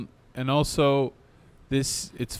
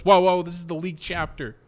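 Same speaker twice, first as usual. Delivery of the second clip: higher, louder alarmed double-take; startled realization; 6 semitones higher, 5 dB louder than usual. Retold or imitated. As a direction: re-enact catching yourself mid-watch. Acting out sudden realization.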